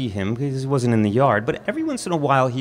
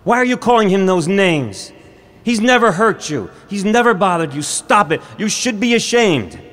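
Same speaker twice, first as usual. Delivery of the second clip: high, loud scolding mother; mother on the porch scolding jeering boys, raised and sharp; angry scolding; 8 semitones higher, 6 dB louder than usual. Retold or imitated. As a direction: tell the kids off like an outraged mother. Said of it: "she yelled at us".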